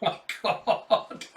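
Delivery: slow words breaking up in laughter; real laughing. Real, not acted. Host cracked up by the misheard question, laughing. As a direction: laugh through the exclamation.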